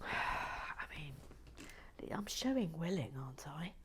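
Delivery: softly confiding; whispered aside. Real, not acted. A sigh, then a whispered admission to listeners. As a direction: say it under your breath. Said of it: "whispered aside".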